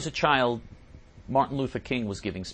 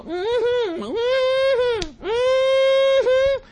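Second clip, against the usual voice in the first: very high falsetto hums and whoops; hammed-up crowd shrieking encouragement; mock ecstatic congregation; 16 dB louder, much slower than usual. Host imitates the audience during the speech, mock frenzied cheering. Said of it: "And they'd all go".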